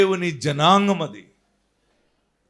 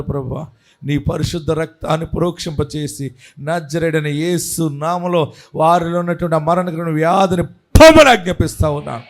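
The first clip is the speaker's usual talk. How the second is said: fervent prayer; 4 semitones lower than usual. Acted. Prays over the sick.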